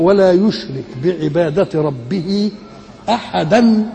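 slow recitation, "أحدا" ending in a nasal n, "ahadan"; connected ending. Shown as a demonstration. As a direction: recite slowly, lifting "أحدا" and sounding its tanween. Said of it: "إنما على الوصل". (تلاوة موصولة بتنوين "أحدا")

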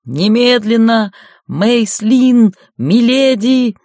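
loud, high urgent shouting; yelling knight. A narrator's reading. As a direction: bellow through the door. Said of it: "голос рыцаря Гринда орал".

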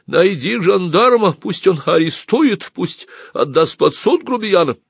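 high, drawn-out indignant cry; loud, high-pitched old lady's complaint; old woman crying out. A narrator's reading. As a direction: call out in outrage as an old woman. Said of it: "крикнула старуха".

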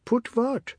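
put-on high, small startled question from a boy; boy's voice. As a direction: squeak it out, caught off guard. Boy answering in fear.

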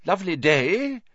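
lightly voiced official; Fudge's voice. A narrator's reading. Fudge at the steps.

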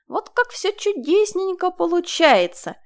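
high, slow, sing-song; thin little voice. A narrator's reading. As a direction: sing it in a tiny voice. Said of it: "тоненьким голоском пропела Марина".

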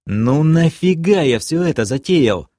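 why-did-I-do-this exasperation; exasperated inner thought. A narrator's reading. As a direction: wail it inwardly at your own bad idea. Rueful thought mid-flight.

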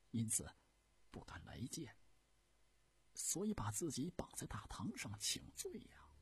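lightly voiced humble servant's report; deferential eunuch explaining. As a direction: explain carefully to the Empress. (太监恭敬地回话解释)

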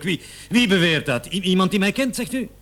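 stammering, building panic to a demand; agitated demand. Acted. Accused suspect demands who accuses him. (opgewonden, steeds hoger en luider)

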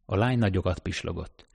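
usual speaking voice

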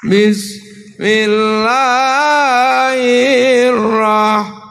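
very slow, drawn-out recitation; gentle recitation. Shown as a demonstration. Gentle Tasik folk reciting, slow drawn-out voice. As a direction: draw it out gently. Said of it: "orang Tasik lembut".

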